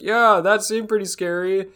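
high, loud hammed-up game dialogue; mock character concern. Mocking game characters to co-hosts, in a high, loud hammed-up voice. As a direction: overplay a worried heroine. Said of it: "They're like".

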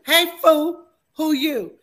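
hammed-up tough challenge. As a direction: repeat it bigger, mock tough. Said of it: "Not even a cool fool".

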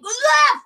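loud, high mock scream; startled yelp. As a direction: yelp as if caught off guard. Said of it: "I didn't even know how to react".

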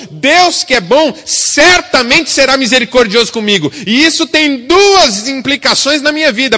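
loud, high impassioned preaching; fiery pulpit delivery; forceful preaching. Acted.

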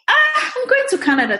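imitating her younger, thrilled self; excited. Guest imitating her old excited self about Canada, high and eager.

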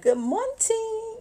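high, slow Good morning; sing-song greeting. Retold or imitated. Greeting the grumpy nephew, high slow sing-song. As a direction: lilt Good morning like a sitcom auntie. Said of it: "I was like".

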